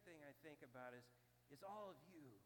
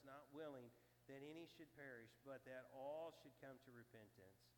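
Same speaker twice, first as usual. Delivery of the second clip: measured, solemn reading of scripture; 4 semitones lower than usual. Acted. Pastor reading scripture to the congregation, solemn and measured.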